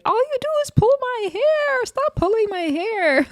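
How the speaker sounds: very high, whining plea; whiny complaint. Retold or imitated. Jaden pinned on the table, high whiny protest. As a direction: wail it like the one losing the fight. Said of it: "poor Jaden say".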